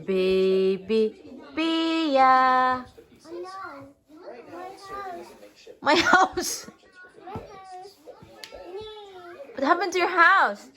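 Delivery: high cooing sing-song. Real, not acted. Baby talk while dressing child.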